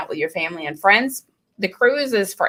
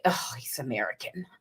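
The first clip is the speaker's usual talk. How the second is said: much quieter, lower sneering local; mock disdainful Scot; 8 semitones lower, 13 dB quieter than usual. Scots in the pub sneering at the American, quiet and low. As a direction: scoff at the outsider. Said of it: "everyone would be like".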